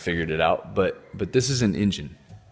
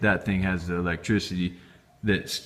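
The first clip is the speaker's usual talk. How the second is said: ordinary talk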